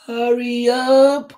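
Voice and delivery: high, loud, drawn-out call; friend calling out. A narrator's reading. Friend calling Tisha to lunch, high loud call. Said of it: "called her friend".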